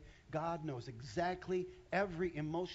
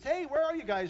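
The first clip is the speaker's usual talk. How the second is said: 1 God calling out; 11 semitones higher, 11 dB louder than usual. Retold or imitated. God calling Adam and Eve.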